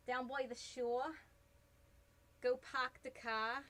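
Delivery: slow, light New Jersey accent, done on request; a hint of a Jersey shore local, drawn out slowly. Shown as a demonstration. Streamer obliges chat with a half-hearted New Jersey accent. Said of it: "in that New Jersey accent".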